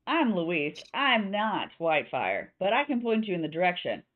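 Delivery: slightly Southern barkeep; Southern innkeeper setting terms; Louisa. Acted. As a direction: speak with a light Southern accent. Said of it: "I don't know why she's slightly Southern".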